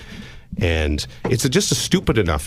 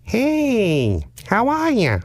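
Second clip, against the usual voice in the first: high, sing-song creepy caller voice; odd prank caller; much slower than usual. Retold or imitated. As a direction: play the weird caller way up high. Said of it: "And the voice at the other end says".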